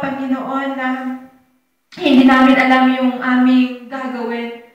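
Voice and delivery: earnest pastor's prayer, pleading and grateful; heartfelt closing prayer voice. Acted. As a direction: pray aloud for the congregation, humbly.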